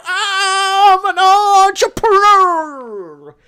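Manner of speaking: high, loud drawn-out Southern drawl; Southern accent. Acted. Mocking landlords in a hammed-up Southern accent. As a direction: play a smug Southern landlord. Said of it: "I like to talk in a southern accent".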